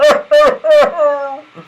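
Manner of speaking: high, loud Santa laugh; jolly laugh. Acted. Santa laughing at his own size, high and loud. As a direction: laugh heartily.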